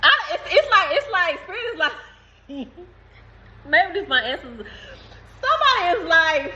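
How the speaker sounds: high-pitched, breaking up with laughter; helpless laughter. Real, not acted. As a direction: struggle to talk through real giggles. Breaks up laughing mid-message.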